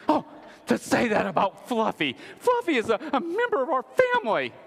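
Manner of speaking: high, hammed-up indignant pet lover; exaggerated high-pitched outrage; mock offended pet owner. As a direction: wail it like an affronted pet parent. Mocking an offended pet owner's reply.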